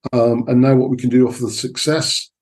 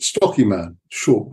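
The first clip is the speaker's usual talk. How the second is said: ordinary talk, nothing marked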